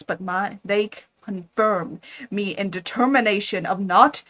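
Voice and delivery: loud, firm refusal; defiant anger. Acted. Frankenstein defies his creature, loud and angry. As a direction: throw the commands at him.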